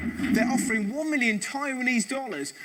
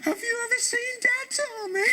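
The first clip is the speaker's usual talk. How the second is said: mock tearful parent; 12 semitones higher than usual.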